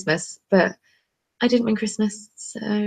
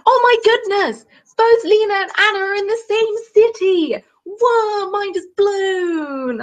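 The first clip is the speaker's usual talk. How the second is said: amazed exclaim; 13 semitones higher, slower than usual. Real, not acted. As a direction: gasp at the coincidence. Exclaiming coincidence.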